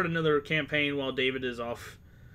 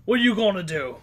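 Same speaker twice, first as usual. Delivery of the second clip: taunting thugs; 6 semitones higher, 8 dB louder than usual. Acted. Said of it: "And they're like".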